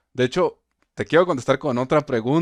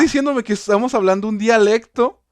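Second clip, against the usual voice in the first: excited protest; 10 semitones higher, 7 dB louder than usual. Real, not acted. Excited objection to the teacher.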